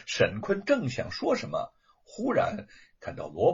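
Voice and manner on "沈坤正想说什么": ordinary delivery, nothing marked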